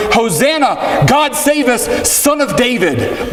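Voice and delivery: high, slow joyful acclaim; declaring. Retold or imitated. Palm-waving crowd hails Jesus, high and slow in joy. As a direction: lift "Hosanna" high and stretch it out. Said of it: "they are declaring".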